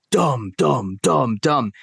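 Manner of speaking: thudding stomp noises mimicked; imitated stomping. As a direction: mimic a kid stomping upstairs. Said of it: "trying to stomp holes in the floor".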